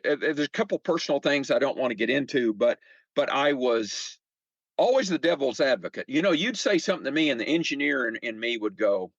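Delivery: ordinary talk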